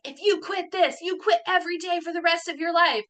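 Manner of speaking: high-pitched angry scolding, imitated; mad manager. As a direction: mimic an outraged boss telling someone off. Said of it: "she got so mad and she's like".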